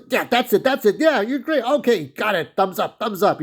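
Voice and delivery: high, bubbly, over-eager greeting; a chirpy imitation of Gerard; cheery guy imitation. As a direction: mimic a grinning people-pleaser. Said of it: "he's that cheery, cheery guy".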